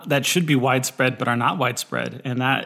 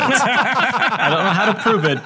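laughing; 8 semitones higher, 3 dB louder than usual. Real, not acted. Laughing at own joke.

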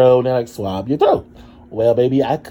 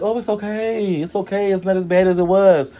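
high-pitched mock soothing; mock reassurance; 8 semitones higher than usual. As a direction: imitate someone cooing consolation. Imitating staff consoling them.

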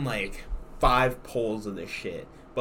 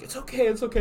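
put-on soothing girlfriend; 10 semitones higher, faster than usual. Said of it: "she's like".

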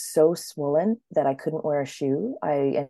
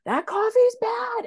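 freaking-out doctor. Retold or imitated. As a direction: shriek it in mock alarm. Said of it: "he's freaking out".

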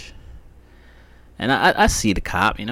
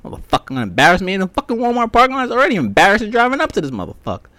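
high, loud, fast outraged complaining; retelling a traffic stop, ranting high and fast; 11 semitones higher, 5 dB louder, faster than usual. Retold or imitated. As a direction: rant in disbelief, pitched up and racing. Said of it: "I'm like".

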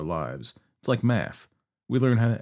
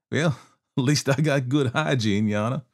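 higher, louder, smug with a laugh; laughing cocky outlaw; 5 semitones higher, 4 dB louder than usual. Acted. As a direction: brush off the judge with a chuckle. Billy replies with a laugh.

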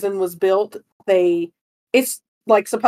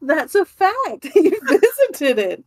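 real laughter, higher and slower; still laughing; 7 semitones higher, slower than usual. Real, not acted. Insisting Dickens visited, still laughing helplessly. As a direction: squeeze the words out between giggles. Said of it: "I'm crying".

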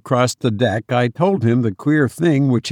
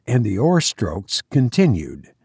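plain reading voice

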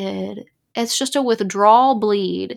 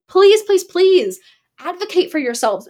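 urgent plea; 8 semitones higher, 4 dB louder than usual. Real, not acted. Pleading with viewers.

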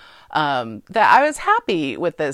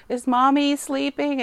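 a lightly voiced little girl, higher and slow; a hint of a small child asking; little daughter; 10 semitones higher than usual. Imitating the president's daughter in the hospital, lightly higher childlike. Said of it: "then he's just like".

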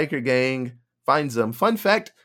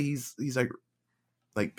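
normal talking voice

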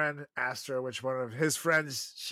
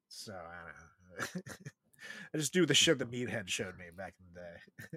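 usual speaking voice

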